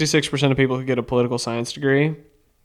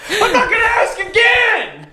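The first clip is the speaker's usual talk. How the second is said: high, slow, laughing mock yell; angry cop shout; 4 dB louder than usual. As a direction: scream it like a hammed-up cop. Mock cop yelling at driver.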